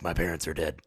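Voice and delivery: low, gruff superhero growl; deadpan low and gruff; gruff Batman. Retold or imitated. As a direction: do a deep, brooding gruff voice. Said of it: "Everything is really gruff".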